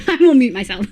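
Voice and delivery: talking through a laugh. Real, not acted. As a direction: let laughter spill into the words. Laughs about muting while eating.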